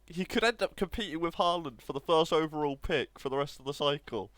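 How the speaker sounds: ordinary talk, nothing marked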